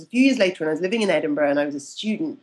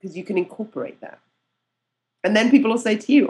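ordinary delivery

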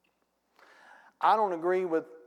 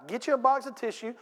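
ordinary delivery

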